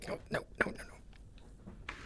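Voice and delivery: hushed urgent whisper. Real, not acted. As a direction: whisper it quickly under your breath. Urgent aside, whispered off-mic.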